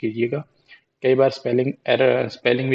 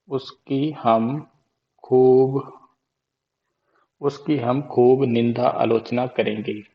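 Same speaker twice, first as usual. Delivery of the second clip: slow emphatic mnemonic; slower than usual. Shown as a demonstration. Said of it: "mnemonic chant, slow".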